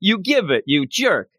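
high, slow teasing heckle; mock heckler. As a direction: tease yourself as a heckler. Mock retort to own score.

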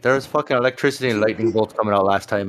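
fast, nervous rambling; Mac rambling. Acted. Mac explaining why he needs a table, fast and nervous. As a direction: rush it out like a worried man. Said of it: "Mac is sitting there going".

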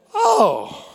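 very high, sudden "Oh"; light bulb going on; mock realization. Flipping kettle base, realization.

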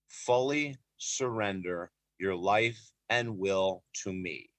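very slow, low and measured; hushed words from heaven, spaced out; solemn divine message. Retold or imitated. God to a praying man on the track, slow and solemn.

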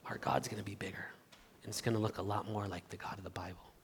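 a soft whispered conclusion; hushed whisper. Real, not acted. Sermon point drops to hush.

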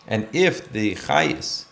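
ordinary talk, nothing marked

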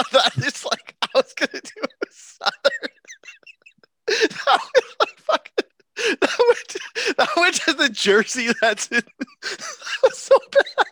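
high, squeaky laugh-talk; helplessly cracking up, words squeezed through laughter; laughing hard. Real, not acted. Podcaster cracking up at a botched accent, real laughing fit.

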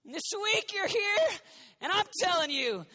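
rally the listeners with fire; preaching. Acted. Preaching call.

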